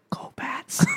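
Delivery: faint unvoiced Boston whisper. Dying Peeta whispers to Katniss. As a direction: breathe it into her ear as he fades. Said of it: "He just whispers to her ear".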